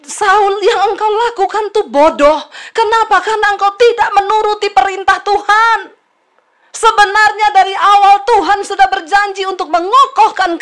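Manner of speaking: high, loud preacher's reproach; stern rebuke. Acted. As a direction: thunder it at a disobedient king. Acted prophet's rebuke of Saul. (teguran keras berapi-api)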